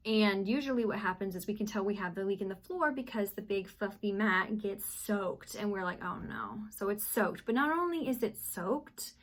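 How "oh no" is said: deflated oh no. Retold or imitated. Discovering the soaked bath mat.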